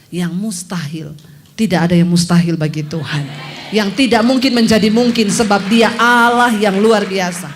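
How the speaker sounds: loud, shouted proclamation; preaching climax. Acted. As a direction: thunder it to the congregation. (khotbah berapi-api dengan seruan keras)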